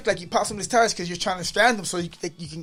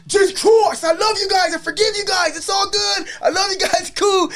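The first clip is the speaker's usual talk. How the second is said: much higher, loud beaming shout; joyful shout; 14 semitones higher, 7 dB louder, slower than usual. Retold or imitated. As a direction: yell out love to them, high and loud. Jumps up shouting love and forgiveness.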